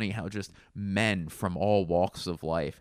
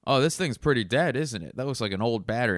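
higher, louder know-it-all tone; bus driver's verdict; 5 semitones higher, 4 dB louder, faster than usual. Retold or imitated. Bus driver judging the battery, higher and louder. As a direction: sure of himself. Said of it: "and he goes".

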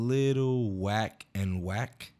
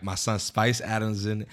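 usual speaking voice

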